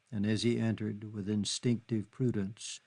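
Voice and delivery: usual speaking voice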